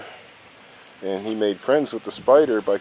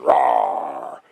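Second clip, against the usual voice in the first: giant roar; 7 semitones lower, 5 dB louder than usual. Retold or imitated. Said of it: "And the giant says".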